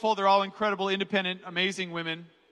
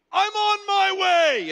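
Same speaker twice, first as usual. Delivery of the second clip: much higher, louder, slow and drawn-out like a movie hero; heroic reply; 13 semitones higher, 8 dB louder, much slower than usual. Retold or imitated. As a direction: answer like a hero in a film, stretching every word.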